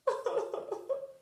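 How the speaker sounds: high, hammed-up little sob; a playful pretend cry, pitched high; mock sob. Hoctopies cries in story.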